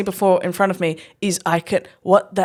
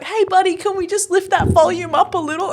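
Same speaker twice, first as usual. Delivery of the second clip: high-pitched mock-polite coaxing; coaxing the kid; 12 semitones higher than usual. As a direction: gently prod a child in a high voice. Retelling coaxing the quiet singer.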